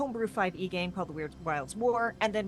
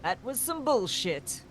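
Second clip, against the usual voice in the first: quoting a TV show; 3 semitones higher, slower than usual. Retold or imitated. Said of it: "I am going to quote it".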